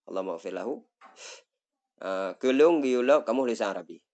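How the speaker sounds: ordinary talk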